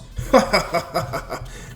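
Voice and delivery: long, jeering cackle; mocking laugh. Vegeta laughs at Goku's bad luck, gloating. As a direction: laugh loudly at a rival.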